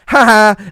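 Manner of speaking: loud, high mock "ha ha"; jeering two-beat cackle; taunting fake laugh. Germans teasing the French across the border, taunting laugh.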